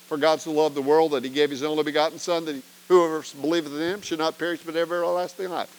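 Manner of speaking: fast recitation, rattled off from memory; quick, routine recital of a well-known verse. Shown as a demonstration. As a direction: run the whole verse quickly like a memorised line. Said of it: "How would I help you say".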